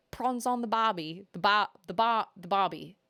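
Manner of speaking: put-on Australian with a broad "barbie"; mock Australian catchphrase; Aussie accent. Shown as a demonstration. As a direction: try out an Aussie accent, repeating the word. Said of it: "certain things with an Aussie accent".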